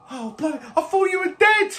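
high-pitched disbelief; high voice rising to a loud exclamation; shocked friend. Acted. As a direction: blurt out in stunned relief. Shocked reunion in the game.

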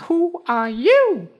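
very high tiny cricket voice. Acted. Cricket asks tortoise.

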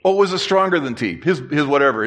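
ordinary talk

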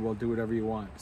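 ordinary talk, nothing marked

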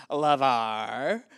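loud name with a rising, drawn-out end; mock group accusation. Classmates all turning on LeVar, mock accusing chorus. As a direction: imitate a whole class calling out the culprit. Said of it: "everyone kind of, like, simultaneously goes".

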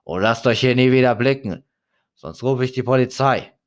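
loud threat to shoo them away; angry shout. Acted. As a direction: yell him off the doorstep. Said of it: "rief er".

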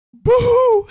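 high, loud mock wail; imitating crying. Imitating Nicolas Cage crying in the movie, high loud wail. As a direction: wail it like a fake sob. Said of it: "He's like".

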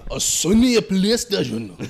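slow mock Japanese accent; put-on Japanese accent, drawn out. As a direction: drag out a hammed-up Japanese accent. Mock Japanese brand names.

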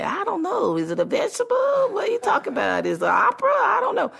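very high-pitched, hammed-up confusion; baffled passers-by. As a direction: squeak out clueless street answers. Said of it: "And people say".

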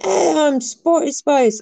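high, drawn-out put-on accent; [name]. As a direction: announce it slowly in a silly mock accent. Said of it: "I don't know why everyone has this accent".

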